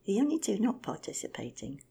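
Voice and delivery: ordinary delivery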